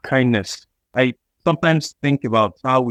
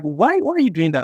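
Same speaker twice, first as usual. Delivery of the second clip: high-pitched questioning why; light, higher-voiced mock objection; mock protest; 7 semitones higher than usual. Voicing a protest over someone's toy.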